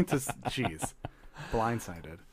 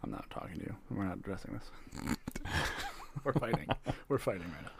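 mock sulky hush; 5 semitones lower, 13 dB quieter than usual. Mock fight, turned away.

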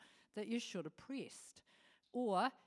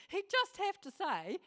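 ordinary talk, nothing marked

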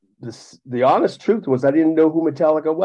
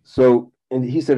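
normal talking voice

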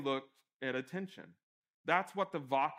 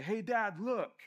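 put-on high, eager kid calling dad; lightly acted child's call, pitched well up; child seeking attention; 7 semitones higher, slower than usual. Child at the pool wanting dad to notice, calling out. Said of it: "they just want their dad to notice".

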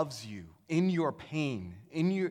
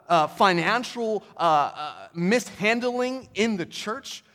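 retold anger; 5 semitones higher, 7 dB louder than usual. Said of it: "he's just livid".

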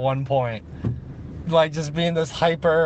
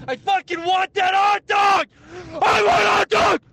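high screamed demand, building higher; craving outburst; 14 semitones higher than usual. Pretend. Friend still craving a hot dog years later, screamed tantrum. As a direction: wail it like a desperate tantrum, in separate bursts.

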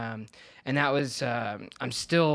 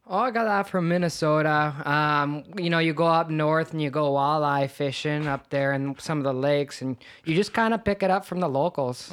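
folksy Minnesotan storyteller, a bit louder; Minnesota accent; 3 dB louder than usual. Pretend. Guest explaining the accent, still in Minnesota accent. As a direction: talk walleye fishing like a lake local. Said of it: "where did you get that accent from".

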